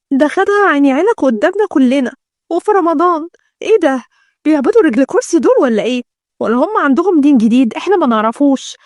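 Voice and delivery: usual speaking voice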